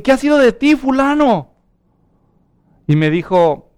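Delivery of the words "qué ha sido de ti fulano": higher, louder acted hail to an old acquaintance; retold surprised greeting. Preacher replays meeting a former bandmate, surprised greeting. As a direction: call out as if running into someone long lost.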